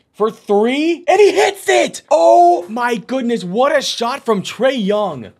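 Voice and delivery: very high, loud, slowed yell; excited shout. Real, not acted. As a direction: explode on the tying shot. Game-tying three.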